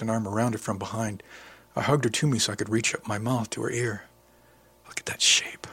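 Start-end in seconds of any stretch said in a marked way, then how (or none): 4.9-5.7 whisper. A narrator's reading. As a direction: breathe it right into her ear. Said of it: "I whispered".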